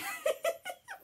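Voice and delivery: a short, high real giggle. Real, not acted. Grandma giggling about Lily catching up in height. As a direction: giggle at the joke about being eye to eye.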